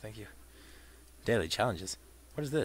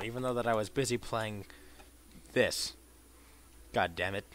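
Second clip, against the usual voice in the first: ordinary talk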